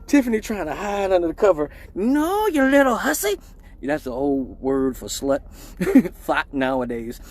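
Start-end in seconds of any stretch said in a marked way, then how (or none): 1.9-3.4 high scolding mom; high hammed-up mom scolding; angry mom voice. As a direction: scold like his mom kicking the door in.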